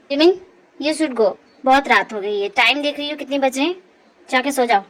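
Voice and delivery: low male character voice, firm; stern young man. Acted. Man telling Jimin to go sleep late at night, stern. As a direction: drop well down and send her away. Man sends her to bed.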